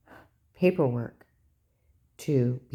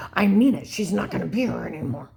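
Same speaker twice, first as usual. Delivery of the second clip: louder, higher start, imitating a threat; insistent taunt; 4 semitones higher, 4 dB louder than usual. Ex-husband taunting the upset grandson, insistent. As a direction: hammer it home as a mock threat.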